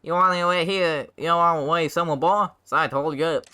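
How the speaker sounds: high, loud character voice; pushy rascal. Acted. Bacchus addresses the young hero, pushy high voice. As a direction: push it out high and insistent.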